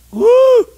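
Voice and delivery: high, loud preacher's woo; sudden high pulpit holler; exultant whoop. Real, not acted. Preacher whoops after scripture line.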